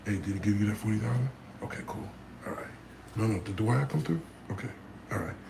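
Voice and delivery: low, soft, fast businesslike mutter; Johnny Dang real-life voice. Retold or imitated. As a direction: drop the act, talk quick and hushed about the money. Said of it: "Johnny Dang probably talk like this in real life".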